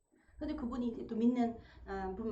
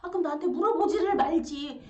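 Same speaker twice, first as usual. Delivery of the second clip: higher, louder irritated retort; husband snapping back, higher and louder; acted irritation; 6 semitones higher, 9 dB louder than usual. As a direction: throw it back, annoyed. Acted marital quarrel.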